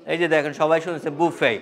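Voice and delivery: normal talking voice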